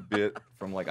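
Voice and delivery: ordinary talk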